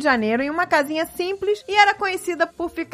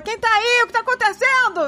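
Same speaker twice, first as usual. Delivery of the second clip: neighbour calling back; 8 semitones higher than usual. Retold or imitated. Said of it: "gritou de volta".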